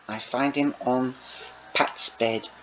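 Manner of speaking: plain reading voice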